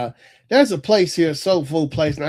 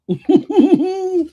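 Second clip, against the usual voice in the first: a sudden, high, loud burst of laughter; 7 dB louder than usual. Real, not acted. Host cracking up at a tongue twister, high loud laugh.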